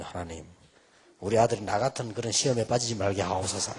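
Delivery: soft, low earnest praying; murmured prayer recalled; hushed retold prayer. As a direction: pray quietly for your son's sake. Retold prayer for his son.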